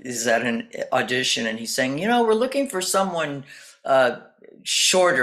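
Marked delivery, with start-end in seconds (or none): 2.0-5.2 casting director voice. Retold or imitated.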